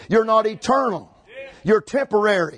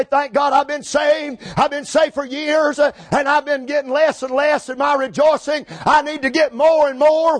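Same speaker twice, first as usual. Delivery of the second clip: raised, high preaching cry; impassioned testimony; 4 semitones higher than usual. Acted. Models what congregation ought to say.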